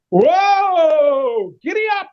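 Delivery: high calling-out to the horse; hollering at the horse, high; horse driver call. Acted. Driver calling to his horse, high hollered whoa. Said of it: "the horse driver went".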